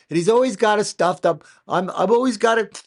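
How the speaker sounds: loud, high Trump-style voice; mock Trump sounding stuffed up; high, loud playful Trump take; Trump accent. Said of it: "he talks like".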